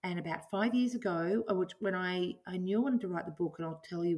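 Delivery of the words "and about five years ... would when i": usual speaking voice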